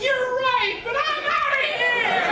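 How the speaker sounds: very high, loud screamed exit; mock panicked shout. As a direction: scream it as the fleeing driver. Driver flees the oncoming train.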